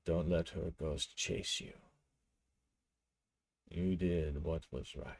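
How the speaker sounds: low, hushed murmur into his ear; whisper. A narrator's reading. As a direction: confide it very softly and low. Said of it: "he whispers into his ear".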